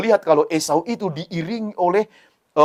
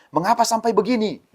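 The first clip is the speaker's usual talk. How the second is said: surprised question; 9 semitones higher than usual. Acted. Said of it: "Dia bilang seperti itu kan".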